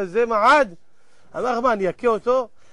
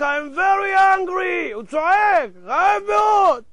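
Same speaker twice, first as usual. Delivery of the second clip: high, drawn-out cry of hunger; starving man shouting; 7 semitones higher, much slower than usual. Acted. Starving prisoner crying out for food, hammed-up shout. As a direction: stretch it into a high, hammed-up wail. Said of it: "הוא כבר צועק".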